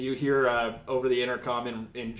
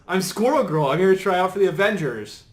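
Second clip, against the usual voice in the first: put-on higher, louder eager teen announcement; very excited; 6 semitones higher, 8 dB louder than usual. Squirrel Girl to Iron Man at her tryout, very excited. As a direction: introduce yourself like an excited tryout hopeful. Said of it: "Very excited".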